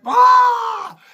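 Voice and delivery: very high, loud yowl; cat attack scream. Retold or imitated. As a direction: shriek like a cat leaping on its prey. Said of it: "jumped out and went".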